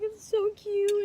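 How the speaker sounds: high, loud, stretched-out squeal; happy gushing. Retold or imitated. Woman imitating her sister at engagement news, gushing happily. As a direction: gush like a delighted sister. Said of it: "she was just so happy".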